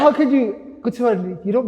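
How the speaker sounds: a loud, high emotional cry; overwhelmed exclaim. Real, not acted. Moved by the friend's message, crying out high and loud. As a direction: burst out, too moved to finish.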